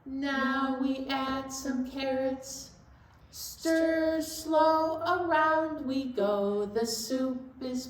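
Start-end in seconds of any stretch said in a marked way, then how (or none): none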